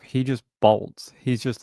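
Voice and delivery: normal talking voice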